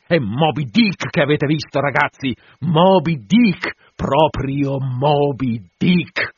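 loud, drawn-out cry of recognition; exultant outcry. Acted. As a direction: proclaim the whale's name, stretching each syllable. Captain cries out the whale's name.

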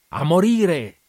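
high, loud cry of a man bent on dying; loud, high manic shout. A narrator's reading. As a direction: fling it out with manic glee. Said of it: "gridò".

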